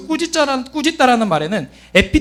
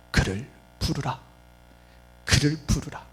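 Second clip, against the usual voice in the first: low, hushed command; quiet, deep-pitched, measured order; solemn command; 8 semitones lower, 9 dB quieter than usual. Acted. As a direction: say it softly and low, with authority. Acts Jesus stopping to speak.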